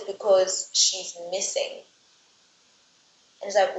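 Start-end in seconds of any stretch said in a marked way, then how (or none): none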